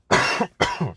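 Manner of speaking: sharp choking cough; coughing fit. Real, not acted. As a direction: cough to clear the throat. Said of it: "sorry, I'm choking".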